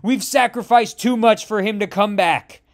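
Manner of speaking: huge opening No then high, loud ranting; cornered god screams at its foe; furious yell. A narrator's reading. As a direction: roar your loss at the enemy. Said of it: "as Ammit yells".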